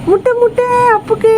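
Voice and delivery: very high squeak. Real, not acted. Owner tempting her pet with eggs, very high squeak.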